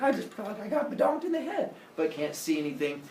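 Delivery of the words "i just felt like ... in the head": high-pitched puzzled hero voice; mock bewilderment. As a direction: play baffled, pitched way up. Hero puzzled after invisible knock.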